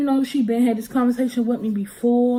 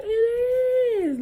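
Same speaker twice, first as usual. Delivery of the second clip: put-on high whiny child. Girl protesting to mom, high and tearful. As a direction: wail it like a kid. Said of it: "I said".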